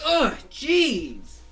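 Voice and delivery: high, loud fed-up cry; exasperated groan. Retold or imitated. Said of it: "Fango's like".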